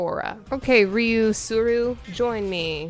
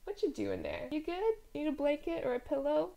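gentle, quiet voice for the dog; soft pet talk; 6 semitones higher, 10 dB quieter than usual. Real, not acted. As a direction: coo softly at the puppy. Talking softly to the puppy.

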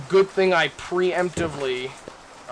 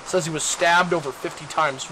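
normal talking voice

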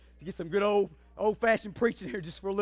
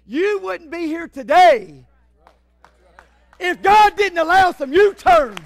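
full-force preaching; 8 semitones higher, 12 dB louder than usual. Acted. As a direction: preach it as loud as you can.

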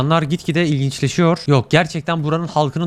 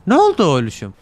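normal talking voice

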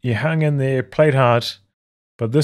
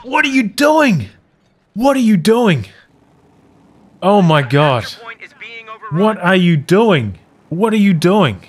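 real angry disbelief; 5 semitones higher than usual. Real, not acted. Caster berating the player's awful play, high angry disbelief. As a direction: ask it over and over in disbelief. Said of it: "I'm so angry".

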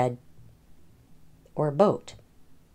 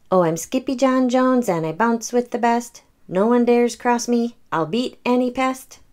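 put-on lifted, higher kid-cat voice, boastful; a cocky little cat bragging as he bounces; Skippy John voice; 7 semitones higher than usual. A narrator's reading. Skippy John bragging on his bed, in a higher playful voice. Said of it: "he said".

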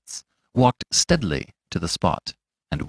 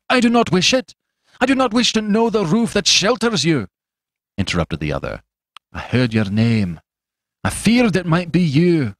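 fierce interruption; 8 semitones higher than usual. A narrator's reading. Fetz cutting off the doctor's offer, fierce bitter refusal. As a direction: refuse him with bitter force.

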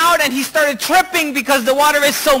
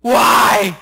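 crying out in pain; 3 semitones higher, 4 dB louder, much slower than usual. Acted. Leper crying out as dirt gets in his wounds, anguished. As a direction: act out a pained protest to heaven. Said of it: "he's crying out of pain".

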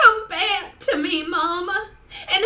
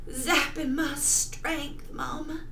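slow, drained and fading; tragic heroine sorrow. Acted. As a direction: drag it out weakly. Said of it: "performing a monologue for you".

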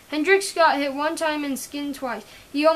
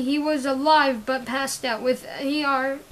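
ordinary delivery, nothing marked

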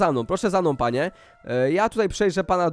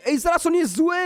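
high-pitched alarmed exclamation; shocked realisation; 10 semitones higher than usual. Pretend. Player realising Blippi is evil, shocked exclamation. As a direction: blurt it out high in playful shock.

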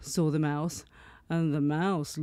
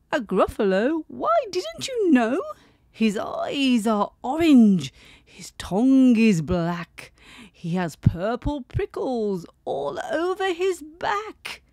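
high, louder spooky mouse tale; 5 semitones higher, 5 dB louder than usual. Acted. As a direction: build the monster up loud. Mouse voiced.

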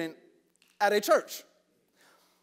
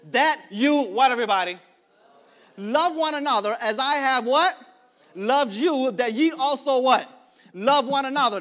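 raised, punchy pulpit reading; preaching scripture; 4 semitones higher, 6 dB louder than usual. Acted. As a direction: preach the verse, cueing the congregation.

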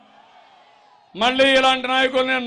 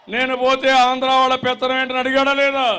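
normal talking voice

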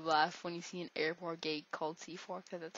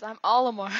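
much louder proud announcement; announcing; 12 dB louder than usual. Pretend. Gamer declaring his chosen fighter, loud announcement. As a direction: proclaim your name.

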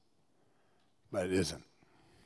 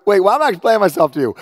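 fast, high, laughing aside; laughing at explaining himself; laughing realization; 11 semitones higher, 13 dB louder, much faster than usual. Retold or imitated. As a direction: catch yourself and laugh. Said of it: "But I'm like".

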